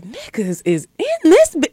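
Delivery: high, drawn-out disbelief; stunned slow reaction; retold astonishment. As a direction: draw it out high and slow in shock. Retold startled reaction to crowd.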